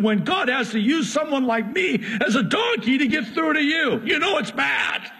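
high, fast, exasperated donkey voice, near shouting; the wronged donkey scolds its rider; indignant talking donkey. Acted. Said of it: "that was a little bit extreme".

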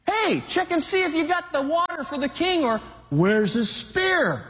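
high, carrying call across a distance; mock yelling. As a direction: call out tauntingly to someone far off. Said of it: "He's yelling all this across the deal".

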